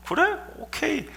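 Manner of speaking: soft, high, light okay; easy assent. Acted. God replying to angry Abraham, soft high okay. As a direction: agree instantly and lightly. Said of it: "하나님께서".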